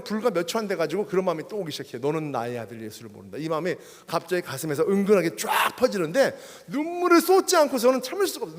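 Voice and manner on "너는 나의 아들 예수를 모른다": gentle inner voice. Retold or imitated. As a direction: let the words settle quietly like a voice within. Inner voice of God speaking.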